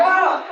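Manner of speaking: sharp high yelp; a shocked squeal; high startled yelp. Retold or imitated. Comedian hit by the woman, yelping. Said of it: "I'm just like".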